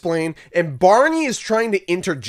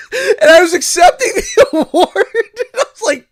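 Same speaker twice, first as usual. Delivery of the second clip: high, squealing through laughter; real laughter bursting into the words; cracking up; 6 dB louder than usual. Real, not acted. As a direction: laugh helplessly while talking, voice shooting up. Cracks up telling the ending.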